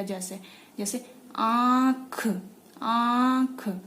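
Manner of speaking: आंख नाक और मुंह दोनों से, धीरे; आंख में चंद्र बिंदु की गूंज सुनाइए; nasal demo. Shown as a demonstration. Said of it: "आप बोल के देखिए". (आंख को नाक से गूंजाकर धीरे बोलिए)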